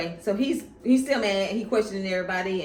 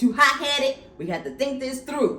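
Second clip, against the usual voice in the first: stern father; 9 semitones higher, 7 dB louder than usual. Retold or imitated. Papa voiced scolding Aegon.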